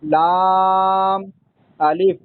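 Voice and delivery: very long लाम held level, pause, then अलिफ; letters read separately. Shown as a demonstration. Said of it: "लाम को अलग और अलिफ को अलग". (लाम को लंबा खींचकर, अलिफ अलग)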